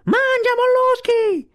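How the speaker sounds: strillo acutissimo e più forte, lento e tirato; grido di scherno prolungato. Acted. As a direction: allunga lo scherno con tutto il fiato. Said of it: "gridò più forte che poté". (Kotick deride Sivic: strillo lento e acutissimo)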